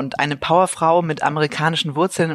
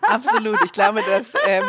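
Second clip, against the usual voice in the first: higher, speaking through laughter; laughing; 6 semitones higher than usual. Real, not acted. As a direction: chuckle while answering. Host laughing after guest's remark.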